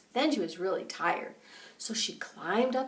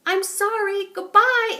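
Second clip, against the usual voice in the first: put-on high, louder panicked little girl; Goldilocks fleeing; 10 semitones higher, 9 dB louder than usual. A narrator's reading. Goldilocks apologizes to the bears and flees, high and panicked. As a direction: squeal it as she runs off. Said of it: "She said".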